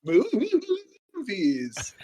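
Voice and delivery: high, drawn-out spooky ghost voice. Pretend. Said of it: "start doing the ghost voice again".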